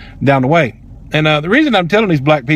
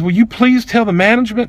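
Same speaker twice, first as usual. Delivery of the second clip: higher-pitched imitation of a hurt associate; hurt plea; 6 semitones higher than usual. Hurt associate asking customer to report it, pleading imitation. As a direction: lightly plead in a raised voice. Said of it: "It hurt her feelings".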